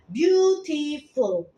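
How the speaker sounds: "Beautiful" stretched slowly into three syllables; syllable demo. Shown as a demonstration. Teacher shows students three syllables, "Beautiful" drawn out slowly. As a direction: draw out "Beautiful" as byu-ti-ful. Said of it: "pengucapannya ada tiga silibus".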